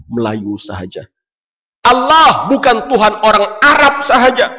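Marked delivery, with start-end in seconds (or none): 1.9-4.5 much higher, louder declaration; rising preaching. Real, not acted. Preacher declaring to the crowd, loud. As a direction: ring it out to the hall.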